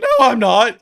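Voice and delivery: high, loud and breaking; the voice jumps up and cracks; voice cracking. Real, not acted. Denying to the girls, voice cracks high and loud. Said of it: "It happened again".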